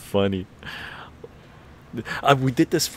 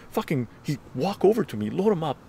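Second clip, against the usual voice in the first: usual speaking voice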